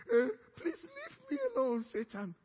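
a limp begging whine; whiny plea. Retold or imitated. Preacher mocks a timid believer pleading with Satan.

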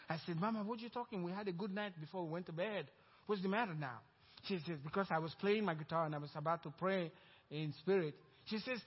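loud, high, fervent preaching; preaching at full force. Real, not acted. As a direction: preach it out to the congregation. Preaching climax of testimony.

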